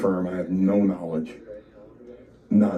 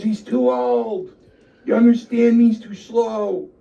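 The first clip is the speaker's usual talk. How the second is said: retold angry boss; 13 semitones higher, 5 dB louder than usual. Chief tells wrestler about Joe, exasperated high shouting. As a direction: lay down the verdict, stretching the words.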